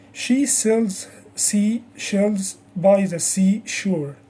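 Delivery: slow, separated words; careful, unhurried practice model; slow tongue twister. Shown as a demonstration. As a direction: say the tongue twister slowly for learners to copy. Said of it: "Say it slowly first".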